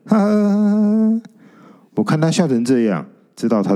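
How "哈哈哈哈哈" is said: put-on ghost girl cracking up in high laughter; high burst of ghostly laughter. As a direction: let the laugh roll out high and long. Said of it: "一次笑个人仰马翻的". (女鬼高声大笑，笑得前仰后合)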